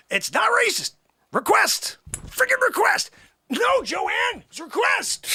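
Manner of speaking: high, insistent, exasperated pleading; indignant protest. Acted. Host defending a request to Joanne, indignant high protest. Arguing back at Joanne offstage.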